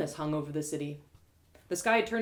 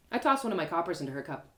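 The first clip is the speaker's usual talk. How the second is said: plain reading voice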